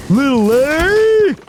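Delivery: put-on slow, high coo to a baby. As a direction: coo it slowly like to an upset baby. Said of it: "I would go like".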